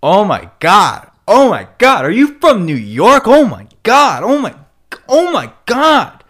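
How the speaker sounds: high, loud mock-horrified squeal; hammed-up, squealing disbelief; mock shocked Angelenos. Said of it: "they're like".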